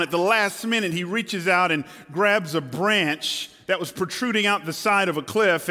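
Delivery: normal talking voice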